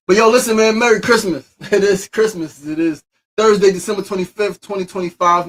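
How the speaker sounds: high, loud Christmas shout; festive hype. Real, not acted. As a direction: burst back into party energy. Sudden festive hype greeting.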